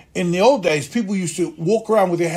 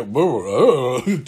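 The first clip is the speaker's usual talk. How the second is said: lower, muttered nonsense lines; mumbled practice gibberish; 6 semitones lower, much slower than usual. Retold or imitated. Young actor rehearsing alone in a corner, mumbled. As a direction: mumble through the lines to yourself.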